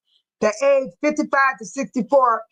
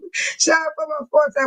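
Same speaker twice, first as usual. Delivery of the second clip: ordinary talk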